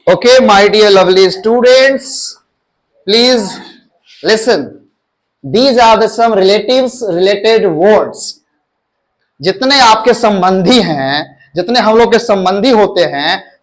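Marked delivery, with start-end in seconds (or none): 0.1-8.2 slow teacher announcement. Shown as a demonstration. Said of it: "teacher announces lesson slowly".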